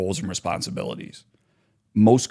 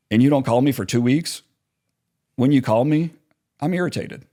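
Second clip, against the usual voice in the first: ordinary talk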